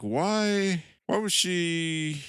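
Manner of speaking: slow, high-pitched drawn-out "why"; puzzled questioning. Real, not acted. Podcaster questions the villain's behavior to co-host, puzzled. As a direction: stretch it upward, baffled.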